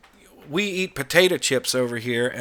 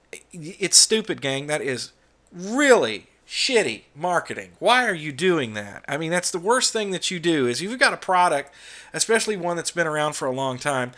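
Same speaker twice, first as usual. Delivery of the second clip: ordinary talk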